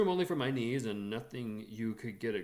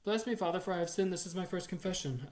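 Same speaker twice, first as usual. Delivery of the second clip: put-on child gabbling the opening prayer, higher; rattled off in one breath; 8 semitones higher, 4 dB louder than usual. A narrator's reading. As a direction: rush it all out in one breath. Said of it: "I rattled off all in one breath".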